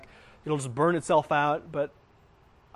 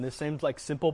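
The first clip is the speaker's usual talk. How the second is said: normal talking voice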